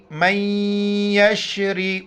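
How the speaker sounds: یشری read forcefully, the doubled letter pressed hard; strong doubled sound. Shown as a demonstration. Teacher shows students how the shadda is read forcefully. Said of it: "کیسے سختی سے پڑھیں گے آپ دیکھ لیں".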